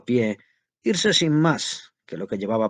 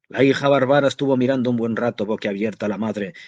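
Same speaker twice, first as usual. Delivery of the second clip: ordinary delivery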